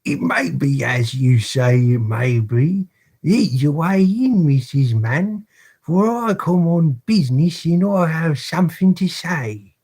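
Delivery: hammed-up British accent, pompous and high; pompous British beadle accent. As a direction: lord it over the matron. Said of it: "the extremely annoying accents".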